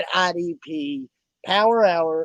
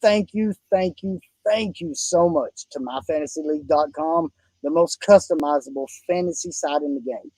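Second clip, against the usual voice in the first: usual speaking voice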